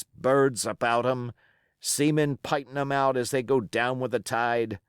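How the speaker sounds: a scornful pirate captain holding his ground; defiant old sea-cook pirate. Acted. Pirate cook rebutting the mutineers' charges, defiant. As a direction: roll the words out like a seasoned buccaneer defending his command. Silver's speech continues.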